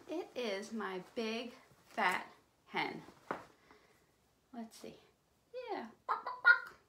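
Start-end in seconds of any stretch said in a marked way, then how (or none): 6.1-6.8 high, loud, short clucks; hen clucking. Pretend. Host answering as the hen, high loud clucking. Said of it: "she makes that funny bark".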